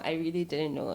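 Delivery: normal talking voice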